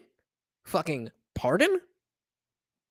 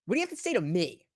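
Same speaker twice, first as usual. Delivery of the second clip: mocking the woman; 3 semitones lower, 6 dB quieter than usual. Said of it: "she's, like, so mad".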